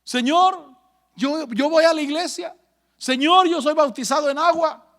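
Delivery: high, pleading cry to God; high desperate retold plea. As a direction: beg as if being dragged to hell. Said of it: "yo le gritaba a Dios".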